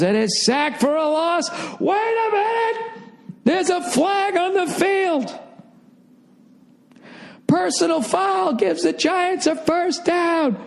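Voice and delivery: overexcited TV announcer calling a penalty; sportscaster. Pretend. Mock sports commentary.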